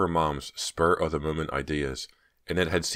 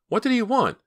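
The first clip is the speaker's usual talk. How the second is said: frightened, much higher and louder; alarmed; 6 dB louder than usual. A narrator's reading. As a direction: demand it in fear. Myra repeats, alarmed.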